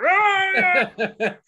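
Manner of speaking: loud, high yell breaking into a laugh; startled mock scream. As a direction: act out a screaming wake-up. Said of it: "Like, out of a sleep".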